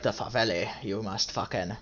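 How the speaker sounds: put-on a weak, lightly done Italian mobster accent, dropping low and soft; half-hearted Italian mobster impression; barely-there Italian accent, trailing off quietly; bad Italian accent. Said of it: "that's not an Italian accent at all".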